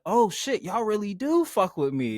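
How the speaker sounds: touched mock amazement; retold pleased surprise. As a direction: imitate someone moved to find real friends. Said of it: "you see something about, something else behind it".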